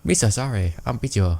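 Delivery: loud put-on Japanese accent. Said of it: "what was that voice?".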